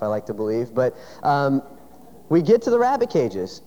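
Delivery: ordinary delivery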